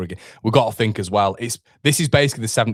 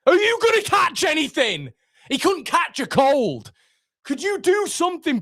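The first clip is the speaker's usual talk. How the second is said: angry shout. Real, not acted. Fan yelling at the goalkeeper during a corner, furious high shout. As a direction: shout your frustration at the screen.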